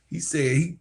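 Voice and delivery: ordinary talk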